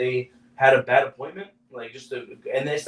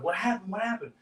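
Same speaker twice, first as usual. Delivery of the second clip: imitating worried gunny; 7 semitones higher, 4 dB quieter than usual. Gunny asks on phone.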